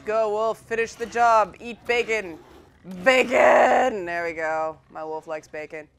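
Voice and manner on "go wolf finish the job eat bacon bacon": loud, raised-pitch commands, building to a yell; calling out orders. Real, not acted. Player urging her wolf onto a boar, loud rising calls.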